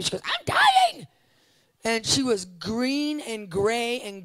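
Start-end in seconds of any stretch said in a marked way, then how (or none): 0.2-1.1 high, loud desperate cry; dying woman's cry. Retold or imitated. Quoting the dying woman, high and desperate. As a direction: cry out like someone at death's door.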